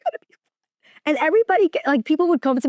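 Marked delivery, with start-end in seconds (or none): 0.1-0.4 soft, choked-up imitation of holding back tears; mock tearful. Crying mom reassures her coworker, mock tearful. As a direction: squeeze it out small, pretending to cry. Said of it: "I started crying".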